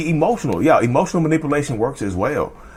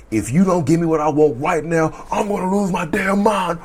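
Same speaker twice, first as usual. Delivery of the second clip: mock rage; 5 semitones higher than usual. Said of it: "Narcissistic rage can be coercive".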